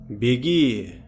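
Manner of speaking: distant flat echo, stress on last syllable; indifferent echo. A narrator's reading. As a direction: echo it back coldly. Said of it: "Равнодушно откликнулось эхо".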